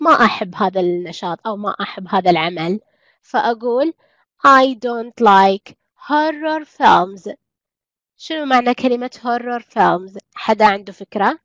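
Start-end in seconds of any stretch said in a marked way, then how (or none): 4.5-7.3 drawn-out teacher modelling; slow classroom example; very slow model sentence. Shown as a demonstration. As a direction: stretch each word for learners. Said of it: "teacher models English sentence".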